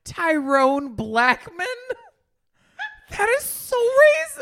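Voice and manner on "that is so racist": high, loud fake sobbing outrage; mock outraged wail. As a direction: wail it in hammed-up offense.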